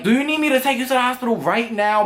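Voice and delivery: a mom on the phone at dawn, hurried and concerned; worried mother. Retold or imitated. Friend's mother calling, concerned.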